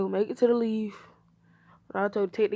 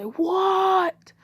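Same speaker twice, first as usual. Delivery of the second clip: high, loud, stretched-out shout; astonished; 11 semitones higher, 6 dB louder than usual. Acted. Teacher learns whose child this is, astonished. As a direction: blurt it out in loud disbelief. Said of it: "He would be astonished".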